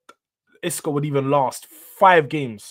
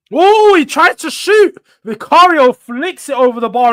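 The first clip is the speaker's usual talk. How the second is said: excited shout; 9 semitones higher, 9 dB louder than usual. Real, not acted. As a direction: yell out at the near miss. Near-miss shot, excited shout.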